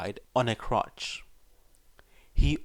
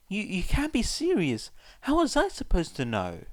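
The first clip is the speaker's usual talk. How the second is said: shocked disbelief; 10 semitones higher, 3 dB louder than usual. Acted. Shocked boy protests.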